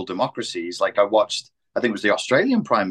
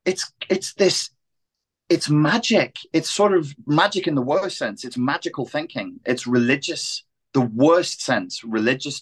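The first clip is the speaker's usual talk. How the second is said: ordinary talk, nothing marked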